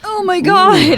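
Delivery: high-pitched laughing exclamation; relieved laughter. Real, not acted. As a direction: laugh it out in delight. Celebrating not saying diegetic.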